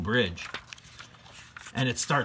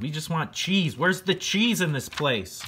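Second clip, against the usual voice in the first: whiny hungry mice; 7 semitones higher than usual. Acted. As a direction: complain like a hungry little mouse. Mice demand cheese.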